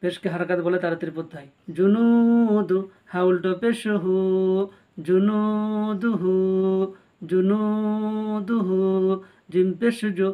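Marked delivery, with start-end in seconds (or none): 1.8-2.8 জুনুদু chanted very slowly, the নু held long; long madd. Shown as a demonstration. The teacher demonstrates the madd in জুনুদু to learners. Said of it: "এক আলিফ টান দিয়ে পড়তে হয়". 5.1-8.8 জুনুদুহু chanted very slowly with long vowels; drawn-out recitation. Shown as a demonstration. The teacher recites জুনুদুহু to learners, drawn out. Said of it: "এক আলিফ টান দিয়ে পড়তে হয়".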